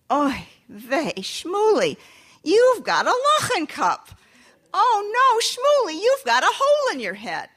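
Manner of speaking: loud, high "oy vey" scolding; a mother's loud despairing lament; exasperated mother. Acted. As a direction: throw up your hands and wail at the boy.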